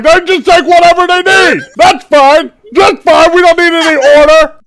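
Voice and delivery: high, loud sarcastic shouting; angry rant. Acted. Furious quartermaster ranting at the captain, shouting. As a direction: rant and slam the last words.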